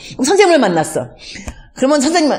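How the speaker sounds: usual speaking voice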